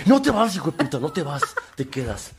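loud, high angry shout, laughing at the end; hammed-up furious yell. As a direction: mimic the partner refusing to let him go. Imitating furious partner again.

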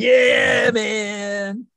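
high, loud hammed-up stoner. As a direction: belt it like a goofy stoner. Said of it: "like a stoner".

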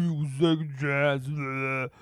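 low, slow mumbled menace; Carter. Retold or imitated. Co-host imitates the film's hero sparing the villain, low slow mumble. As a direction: slur it slowly in a low tough-guy voice. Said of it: "when I saw him, he was like".

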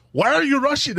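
high, loud, incredulous question; exasperated retold cry; mock bafflement. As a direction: imitate a baffled friend, voice shooting up. Retold baffled reaction to accent slip.

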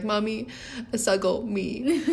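put-on slow, sweet little-child plea; toddler voice. Mother imitating her toddler asking to be snuggled, lightly voiced. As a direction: coo it like a sleepy two-year-old. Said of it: "the way he says it is so cute".

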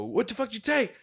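retold anger. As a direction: act out demanding it back from a thief. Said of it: "I was already mad".

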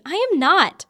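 shouted, high-pitched protest; loud indignant denial. Acted. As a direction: yell the denial, outraged. Repeating her denial, more upset.

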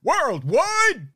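high, drawn-out hype yell; hype-man yell. Pretend. Podcast host playing hype man for the guest's reach, mock yell. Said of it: "I'm supposed to yell in situations like that".

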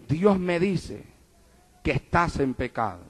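very low, hushed confiding voice; spoken in the ear. Retold or imitated. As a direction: drop it right down, for one listener only. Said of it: "yo le hablé al oído". (hablando bajito al oído)

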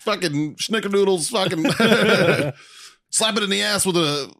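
sassy diner mama barking orders, high; kitchen order callouts. Acted. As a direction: call out orders like a sassy cook. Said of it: "like a sassy, like, mother figure ... calling out orders".

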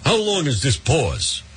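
slow, mocking caller voice; mock the clueless question, drawn out; mock exasperated question. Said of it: "I'm sitting there yesterday like".

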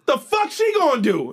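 loud high defiant retort; mock tough kid yelling. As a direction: throw it back loud and high. Classmate defiant.

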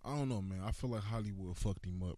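ordinary delivery

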